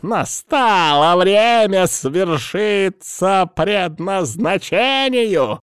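slow, high, drawn-out fanatic snarl; bare the teeth and savour the prophecy; snarling villain. A narrator's reading. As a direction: healer gloating that destiny has come. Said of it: "он оскалился".

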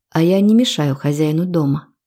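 ordinary delivery

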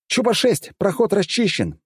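high-pitched, urgent pilot call-in; pilot's radio report. A narrator's reading. Pilot reports the passage cleared to the commander, high urgent call-in. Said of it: "донёсся доклад одного из пилотов".

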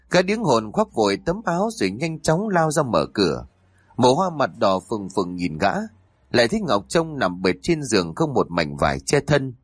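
plain reading voice

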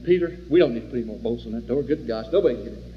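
hint of an easygoing John. John reassuring Peter about the bolted door, fast and low. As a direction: brush off the worry quickly. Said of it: "John walks up to him and says".

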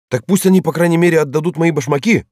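high, loud angry cry; furious outburst. Acted. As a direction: yell it in a fit of rage. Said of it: "вскрикнул Паспорту в припадке бешенства". (крик в припадке бешенства)

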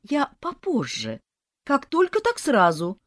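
mock pitiful tenant; pitiful excuse. A narrator's reading. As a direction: plead sweetly to delay payment. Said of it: "изобразила я жалостливую физиономию".

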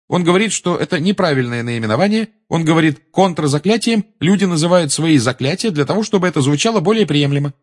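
high, clear pupil reciting; ringing voice. A narrator's reading. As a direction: answer the teacher loud enough for the class. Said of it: "а говорила звонким голосом". (звонкий, высокий голос ученицы)

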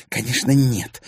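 exclaiming. A narrator's reading. Milana denies she enjoys being humiliated, exclaiming. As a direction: blurt it out in protest. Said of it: "воскликнула Поветруля".

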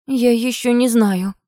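slow, lost and dazed. A narrator's reading. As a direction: let the words come slowly, bewildered. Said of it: "потерянно произнесла Анастасия".

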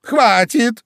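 high, loud, exasperated squeal; shriek. A narrator's reading. Queen stops the bowing gardeners, shrieking. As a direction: squeal it out to stop them. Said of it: "взвизгнула королева".

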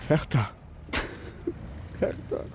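calling her name, then fading to a broken murmur; grief. Acted. Father breaking down over his missing daughter, in grief. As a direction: let the name break and sink away.

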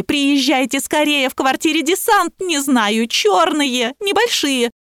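high, distraught plea for help; panicked cry. A narrator's reading. Barbie begging police to come quickly, high panicky wail. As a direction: whine in mock panic, dragging the key words. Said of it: "кричала Барби".

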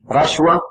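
رشوة with the first vowel a short "a"; fatha on the ra. Shown as a demonstration. Teacher shows students a valid variant, رشوة said "rashwa". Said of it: "بفتح الراء".

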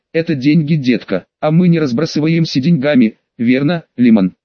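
usual speaking voice

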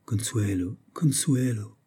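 soft, moved murmur; tender. A narrator's reading. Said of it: "d'une voix attendrie".

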